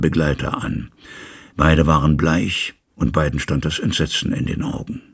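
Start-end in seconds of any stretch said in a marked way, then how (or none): none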